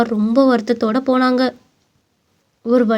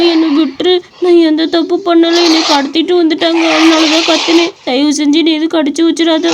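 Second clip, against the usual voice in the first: higher, louder pleading cries; frightened loud begging; terrified child; 4 semitones higher, 3 dB louder than usual. Acted. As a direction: beg the gorilla not to hurt him. Boy faces the gorilla.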